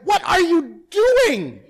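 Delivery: high, loud, drawn-out exasperated demand; wife's alarmed shout. Retold or imitated. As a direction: imitate a wife yelling down the hall. Wife shouts at his hollering.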